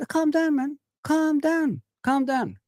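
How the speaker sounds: high teasing calm down, man; sing-song it at him to wind him up; mocking calm down. Fan taunts pundit Gary Neville, high mocking calm down.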